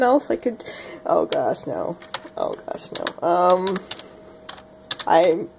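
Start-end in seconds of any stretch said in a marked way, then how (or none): none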